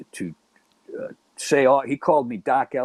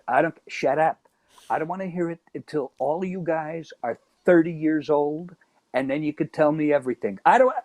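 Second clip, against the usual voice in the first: higher-pitched imitation of a telling-off; retold scolding; 4 semitones higher than usual. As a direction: scold him and allow no reply. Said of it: "she says".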